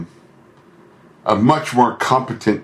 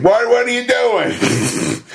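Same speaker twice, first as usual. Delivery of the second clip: higher and slow, drawn out; exaggerated nag; 13 semitones higher, slower than usual. Boss hovers over worker, drawn out and high. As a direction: stretch it out like an exasperated boss.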